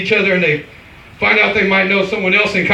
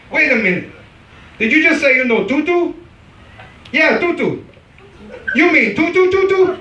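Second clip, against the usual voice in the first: put-on African accent; 6 semitones higher than usual. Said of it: "African immigrants say".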